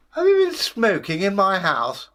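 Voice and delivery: high, loud indignant demand; indignant devil. Acted. Devil finds smoke in his house, indignant.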